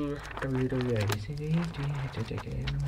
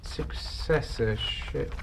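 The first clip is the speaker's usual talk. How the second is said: baffled whisper; 8 semitones lower, 6 dB quieter, much slower than usual. Acted. Baffled by jargon.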